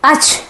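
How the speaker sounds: short high-pitched pretend sneeze; acted sneeze. Elephant sneezing at the shop, acted sneeze. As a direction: fake a sudden "achoo". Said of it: "தும்புச்சு".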